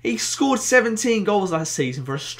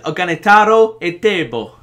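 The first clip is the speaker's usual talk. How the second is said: slightly hammed Italian accent on the name; 7 dB louder than usual. Real, not acted. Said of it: "said that in an Italian accent".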